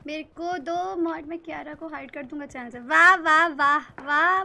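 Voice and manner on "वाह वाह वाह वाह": loud, delighted shouts of praise; excited cheering. Real, not acted. Cheering a good play.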